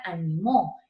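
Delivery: ANIMÓ with the force on MÓ; final-syllable stress. Shown as a demonstration. Teacher showing an aguda, stress on MÓ. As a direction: land on the last syllable of ANIMÓ. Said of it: "se volvió una aguda".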